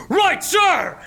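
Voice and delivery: high, loud eager guard reply; guards answering. Acted. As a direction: snap to attention, sir!. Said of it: "as the tollmen go".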